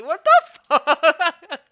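loud disbelief breaking into laughter; incredulous cry through laughter; laughing hard. Real, not acted. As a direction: burst out laughing mid-swear. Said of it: "I'm laughing so hard right now".

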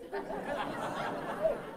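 real high-pitched laugh, breathy and unvoiced; preacher laughing at the pallbearers dropping the body. Real, not acted. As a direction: burst out laughing at the picture.

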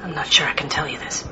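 a mother whispering a shameful secret to the priest. Acted. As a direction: whisper it, ashamed to say it.

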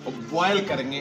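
ordinary talk, nothing marked

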